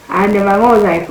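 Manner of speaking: normal talking voice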